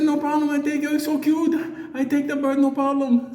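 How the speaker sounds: put-on high, lilting old lady voice with broken English; a sweet old neighbor taking the bird. Old lady agreeing to keep the bird, high and sweet.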